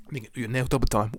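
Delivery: ordinary talk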